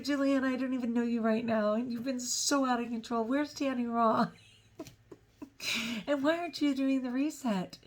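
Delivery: husband scolding his wife, a light mock exasperated voice; imitating husband scolding. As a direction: play the worried husband letting her have it. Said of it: "he just let me have it".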